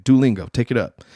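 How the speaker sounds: ordinary talk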